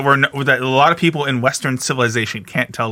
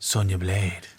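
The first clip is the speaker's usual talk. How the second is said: low, softer creepy villain murmur; creepy Shang Tsung; 8 semitones lower, 9 dB quieter, slower than usual. Retold or imitated. Mocking Shang Tsung's creepy vibe toward Sonya, low and soft. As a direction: purr her name like a lecherous villain.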